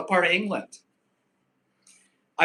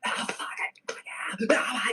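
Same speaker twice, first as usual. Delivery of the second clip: whispered panic breaking into a cry; imitating the scared instinct, breathless; mock panic; 7 semitones higher, 3 dB quieter than usual. Imitating a frightened fighter's instinct, whispered panic. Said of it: "your instinct is to go".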